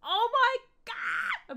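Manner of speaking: high, loud, drawn-out squeal; excited shriek. Real, not acted. Creator thrilled by the light headset, excited shriek. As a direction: shriek it with delight. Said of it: "I'm so excited".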